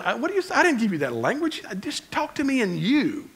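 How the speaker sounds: high, baffled voice of God; God, puzzled. Retold or imitated. God reacting to King James prayers, high and puzzled. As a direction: play God confused by fancy prayer language. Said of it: "God's up there going".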